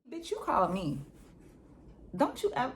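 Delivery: ranting; heated rant. Real, not acted. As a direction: let the outrage burst out. Ranting at rude callers.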